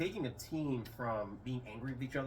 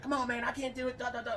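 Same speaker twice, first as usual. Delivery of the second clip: lightly voiced buddy refusing, pitched up; mock the buddy's brush-off in a higher voice; pitched-up imitation of a friend saying no; high put-on friend voice; 12 semitones higher than usual.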